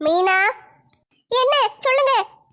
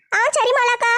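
put-on higher, childlike younger sister complaining; whiny kid sister, voice raised high; 6 semitones higher than usual. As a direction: pitch up as the weary little sister.

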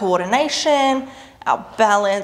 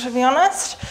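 ordinary delivery

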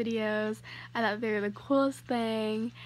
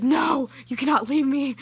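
louder mock-desperate plea; playful loud plea; 3 semitones higher, 9 dB louder than usual. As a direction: beg her to stay, half-joking. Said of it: "I was like".

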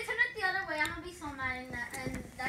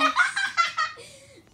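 loud burst of laughter; 11 semitones higher, 10 dB louder than usual. Real, not acted. Friend laughing at zoomed face.

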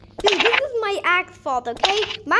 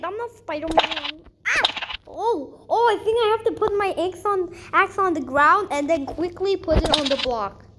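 usual speaking voice

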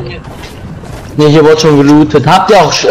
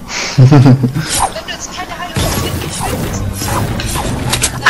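high unvoiced laugh; high breathy laugh; 9 semitones higher, 7 dB quieter than usual. Real, not acted. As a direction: burst into a high airy laugh. Laugh after breaking objects.

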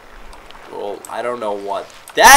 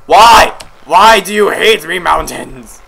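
exasperated wail; 2 semitones higher, 9 dB louder, slower than usual. Real, not acted. Player pleading with the mountains, loud exasperation. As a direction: cry out at the unfair obstacle.

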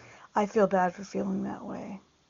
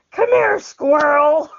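coaxing a squirrel, louder and higher; playful call; 7 semitones higher, 8 dB louder than usual. Pretend. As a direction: lure the squirrel in with a laugh.

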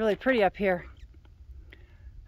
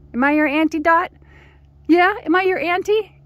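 talking to the dog; 10 semitones higher, 9 dB louder than usual. Real, not acted. Auntie asking her dog, high baby talk. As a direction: coo at the dog high and bright.

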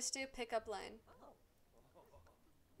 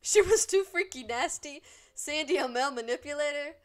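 loud mock scolding; 3 semitones higher, 11 dB louder than usual. Real, not acted. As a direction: tease her Sim for being nasty. Scolding her freaky Sim.

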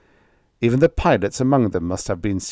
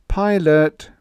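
high, louder pleading address; sad appeal; 8 semitones higher, 6 dB louder than usual. A narrator's reading. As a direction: appeal with a touch of sorrow. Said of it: "Ger said sadly".

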